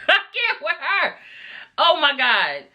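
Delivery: high-pitched laugh through the words; cracking up, can't believe it; laughing disbelief. Real, not acted. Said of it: "Alana let out a wet fart".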